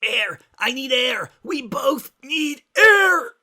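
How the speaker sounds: high, loud and slow gasping for air; shouting while squeezed; wheezing shout. A narrator's reading. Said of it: "Rainbow wheezed".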